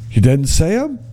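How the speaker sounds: tentative go at a mock Minnesota accent; unsure accent attempt. Guide questions visitor, half-hearted imitated Minnesota accent. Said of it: "I don't know how to do the accent".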